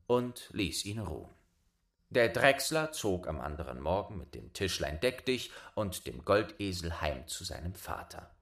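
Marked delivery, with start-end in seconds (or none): none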